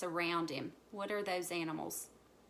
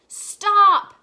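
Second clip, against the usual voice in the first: loud, high single burst; full shout; 13 semitones higher, 22 dB louder than usual. A narrator's reading. Chief frog halts squabbling animals, loud high shout. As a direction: shout to halt everyone. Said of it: "the chief frog shouted out".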